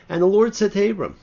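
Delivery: loud, high proclaiming of the verse; declaiming scripture. Acted. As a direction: ring out the angel's call from heaven.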